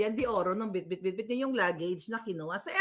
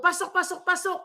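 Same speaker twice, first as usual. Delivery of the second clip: higher-pitched, lightly acted repeated call; urging people in; 7 semitones higher, 3 dB louder than usual. Host mimics presenter calling people inside, urging. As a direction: repeat it as a mock summons. Acting out Tulfo ordering people in.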